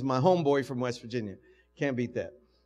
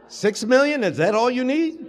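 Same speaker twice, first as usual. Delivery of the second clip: much higher and louder, laughing disbelief; eager sponsor; 10 semitones higher, 11 dB louder than usual. Retold or imitated. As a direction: act the delighted sponsor. Retold sponsor's eager reply.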